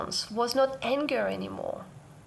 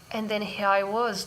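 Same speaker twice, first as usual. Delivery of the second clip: ordinary talk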